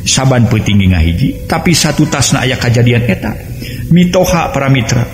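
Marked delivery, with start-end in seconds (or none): none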